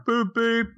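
high, bright-voiced boop beep like a car horn; car horn imitation. Roy honking at the boys, mock car horn. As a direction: honk it out in a high voice. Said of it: "he blows the horn".

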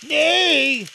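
put-on high, loud, stubborn boy; obstinate refusal. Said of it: "growing obstinate".

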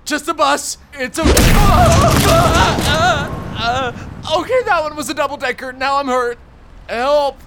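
high strained pained cries; terrified horror victim. Acted. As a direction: cry out hurt after the bus. Hit by double-decker bus.

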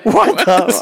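high, laughing stunned gasp; laughing disbelief. Retold or imitated. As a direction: laugh through the shock.

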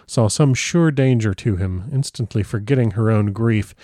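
usual speaking voice

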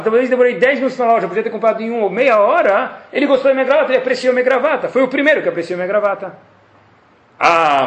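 ordinary talk, nothing marked

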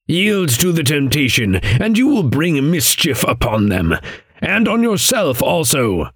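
high-pitched, emphatic admonition; stern warning figure. Acted. Voices the warning counterpart in dialogue.

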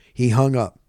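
usual speaking voice

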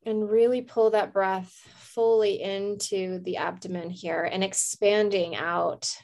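calm meditation voice with long pauses; guided meditation. Real, not acted. Host leads listeners to a candle flame, calm meditation. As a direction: guide them gently, leaving space to breathe.

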